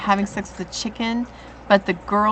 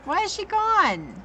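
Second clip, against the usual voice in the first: surprised; 10 semitones higher, 3 dB louder, slower than usual. Real, not acted. Surprised viewer left.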